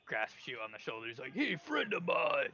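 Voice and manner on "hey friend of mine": higher, slow ogre greeting; drawn-out, high creature friendliness; friendly ogre. Acted. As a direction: greet a new pal as a big ogre. Ogre grasps his new friend.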